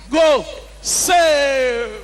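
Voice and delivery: loud stage-host call; hype announcement. Acted. Host calling the next comedian to the stage, loud. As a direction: call the act up like an MC.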